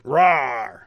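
playful rawr like a bear; toy-bear roar; bear growl. Shown as a demonstration. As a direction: imitate a bear's roar in one burst. Imitates a bear's roar.

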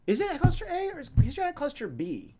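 high, quick questioning voice; put-on sidekick voice. Host voicing a question about personality clusters, put-on sidekick voice. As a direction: pipe up with a high-voiced query. Plays querying George in put-on voice.

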